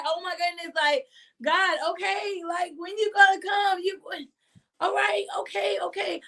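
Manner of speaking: impatient whine. Retold or imitated. Impatient believers voiced to God.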